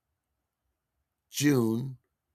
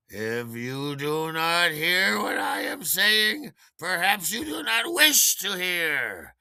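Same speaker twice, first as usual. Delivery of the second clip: put-on slow, loud, higher imperious drunken rant; drunk woman ranting; 7 semitones higher, 7 dB louder, much slower than usual. Drunk caller scolding the DJ, slow grand drunken rant. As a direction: lecture him slowly and grandly like a tipsy lady. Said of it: "and she said".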